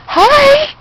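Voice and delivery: high sing-song puppy greeting. Real, not acted. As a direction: coo at the puppy. Greeting puppies.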